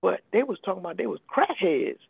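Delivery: normal talking voice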